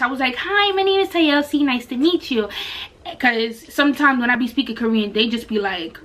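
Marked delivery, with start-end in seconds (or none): 0.3-2.5 sweet English hello. Retold or imitated. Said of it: "then I said it in English".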